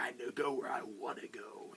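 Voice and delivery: lightly imitated gruff Wolverine voice; a hint of a tough loner growl. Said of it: "did a great Wolverine voice".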